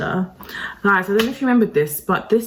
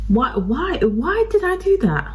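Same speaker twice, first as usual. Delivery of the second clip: exasperated wail; 7 semitones higher than usual. Real, not acted. Vlogger wailing at herself over ruined makeup, exasperated. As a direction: pitch jumps way higher, exasperated.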